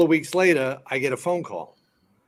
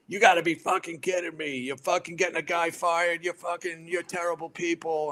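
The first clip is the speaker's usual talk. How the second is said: higher-pitched mock rant, no real volume; retold tipsy outburst, pitched up; imitated angry yelling; 6 semitones higher than usual. Tipsy friend berates actress over fired fireman, mock yelling. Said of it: "here's Johnny Mac yelling at him".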